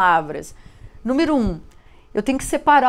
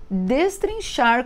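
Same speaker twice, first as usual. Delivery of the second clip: destrinchar drawn out slowly, each syllable apart; slow emphasis; much slower than usual. Shown as a demonstration. Teacher dwelling on her favourite word destrinchar for pupils, slow. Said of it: "como eu costumo dizer".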